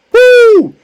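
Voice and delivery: high, loud "woo"; exultant whoop. Real, not acted. Preacher moved by mercy and grace, exultant whoop. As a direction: let out a piercing praise whoop.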